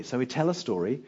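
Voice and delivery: ordinary talk, nothing marked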